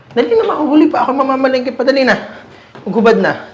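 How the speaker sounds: loud, high, fretful hurrying; impatient nagging. Acted. Mother late for market hurrying her child, impatient and loud. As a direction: nag loudly, pressed for time.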